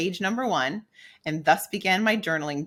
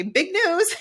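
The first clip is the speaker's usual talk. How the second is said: very high, bright mock-excited announcing; a playful fake announcement on cards; 14 semitones higher than usual. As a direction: sell it like a cheesy greeting card.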